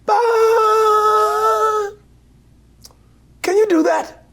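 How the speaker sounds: high, sung-out demo note then question; British director impression. Director demonstrating the exact pitch, high voiced. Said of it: "very British says".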